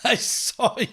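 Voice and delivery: holding back laughter while talking; stifled giggles breaking through; laughing. Real, not acted. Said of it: "I'm trying not to laugh out loud".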